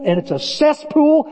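usual speaking voice

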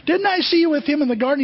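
high, pressing accusation; accusing challenge. Acted. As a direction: call him out, pitch up high. Servant challenging Peter.

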